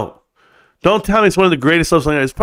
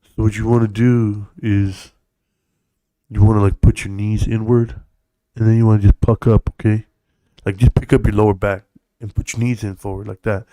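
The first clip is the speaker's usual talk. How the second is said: mock twerk teacher; 6 semitones lower than usual. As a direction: coach it in a low, deliberate deadpan. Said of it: "is a twerk teacher".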